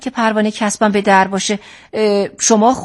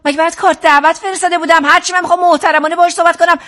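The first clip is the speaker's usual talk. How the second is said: higher, heated and hurried; angry, raised-pitch rant; angry dismissal; 5 semitones higher, faster than usual. Acted. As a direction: throw the client out, fed up. Counselor throws distrustful client out.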